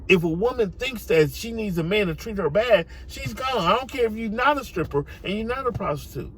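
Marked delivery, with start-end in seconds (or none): none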